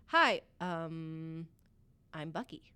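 quiet nervous mumble; tongue-tied, shy hello; awkward shy greeting. Retold or imitated. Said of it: "all I could get out of my mouth is".

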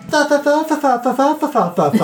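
high, prissy fa-fa-fa babble mocking lords; mock posh lord. Goblin mocking how lords talk, in high prissy babble. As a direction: prance it up in a high, fussy falsetto. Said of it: "They're all like".

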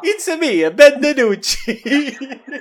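high cartoon Italian voice, drawn out into a laugh; gleeful high-pitched mascot with Italian accent; Super Mario. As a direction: do a squeaky Italian video-game plumber. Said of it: "Super Mario".

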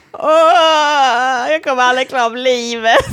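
loud, high opening wail into tearful words; hammed-up bawling, laughter underneath; mock despairing wail. Bartender echoes colleague's verdict about her, wailing in despair. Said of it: "började gråta".

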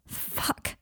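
breathless unvoiced curse under his breath; whispered curse. A narrator's reading. Harry swearing to himself in a whisper. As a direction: let it slip out as a whisper.

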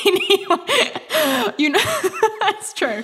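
high, squeaky words through real laughter; laughing. Real, not acted. Laughing at her own lip filler joke, high giggly voice. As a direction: try to move on while cracking up.

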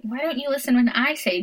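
ordinary talk

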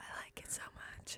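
hushed mock-ASMR whisper. Whispering back to tease the co-host. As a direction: breathe it out with a secret grin.

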